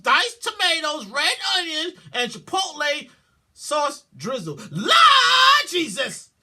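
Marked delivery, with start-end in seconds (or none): 4.7-6.3 loud, drawn-out high wail; slow anguished shout; horrified cry. Real, not acted. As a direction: cry out to the Lord in dismay. Horrified exclamation.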